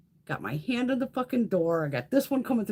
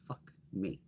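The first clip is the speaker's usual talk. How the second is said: muttered curse; 9 semitones lower, 13 dB quieter than usual. Retold or imitated. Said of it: "I'm like".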